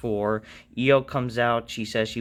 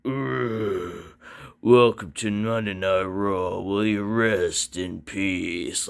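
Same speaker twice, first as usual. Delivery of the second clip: Undertaker impression; 3 semitones lower, much slower than usual. Vlogger imagines the Undertaker saying the catchphrase, slow grim voice. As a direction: drag it out like a dead man. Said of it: "The Undertaker's like".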